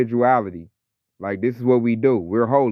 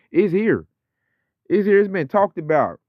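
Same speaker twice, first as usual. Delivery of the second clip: insistent; 7 semitones higher than usual. Real, not acted. Insisting the prophecy has arrived.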